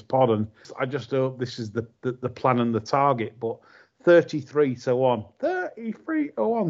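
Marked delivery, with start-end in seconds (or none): 5.4-6.7 put-on Gary Wiltshire's Indian accent, high and far; mock Indian accent call of the odds. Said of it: "says Gary Wiltshire in an Indian accent".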